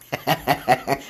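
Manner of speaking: amused laugh at own joke. Real, not acted. Comedian after making fun of a name, amused laugh. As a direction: laugh at your own teasing.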